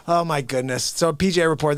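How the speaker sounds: normal talking voice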